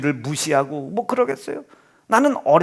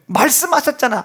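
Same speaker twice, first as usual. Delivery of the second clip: ordinary talk, nothing marked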